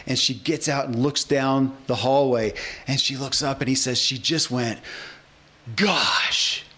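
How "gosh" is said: a drawn-out southern "Gosh", in awe; a child's awed southern "Gosh"; southern drawl gosh. Retold or imitated. Host imitating the girl's awed southern Gosh. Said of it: "in Zig's southern drawl".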